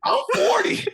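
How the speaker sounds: very high, loud cracking wail; squealing parody of a coach; mock shrill cry. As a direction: screech it in parody. Echoing the rant.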